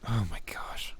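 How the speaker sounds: scandalised whispered oh my gosh; shocked whisper. Real, not acted. GM reacting to a crude joke in a soft whisper. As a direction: gasp it under your breath.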